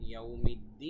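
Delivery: "ইয়াউমিদ্দিন" with the last vowel held very long; long final stretch. Shown as a demonstration. Teacher showing three alifs at verse end, long-held "ইয়াউমিদ্দিন". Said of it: "প্রত্যেকটা আয়াতের শেষেই তিন আলিফ টান".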